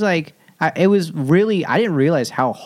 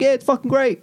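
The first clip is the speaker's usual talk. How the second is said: high-pitched, badly faked Boston accent; hammed-up Boston accent; terrible Boston accent; 11 semitones higher than usual. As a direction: put on a Boston local, badly. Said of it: "That was a terrible Boston accent".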